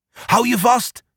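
high call to hold on tight. A narrator's reading. Sloeber to the animals on his back, calling out. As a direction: call up a warning to hang on. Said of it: "roept Sloeber".

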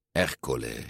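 low, soft, ominous voice; a brooding bully muttering a name; menacing villain. A narrator's reading. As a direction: growl the name darkly.

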